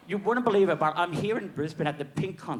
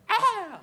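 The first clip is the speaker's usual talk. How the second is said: imitated crowd whoop; 3 dB louder than usual. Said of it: "everyone's like".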